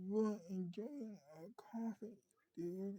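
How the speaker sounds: soft, breathy repeat of a complaint; quiet, deflated grumble; hushed protest. Acted. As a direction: drop to a near whisper.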